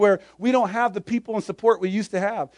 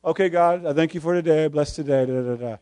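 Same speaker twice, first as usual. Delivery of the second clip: lower, softer, mumbling through a bored routine prayer; rote mock prayer; 8 semitones lower, 6 dB quieter than usual. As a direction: pray it like a habit you no longer hear. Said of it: "we have a daily devotion".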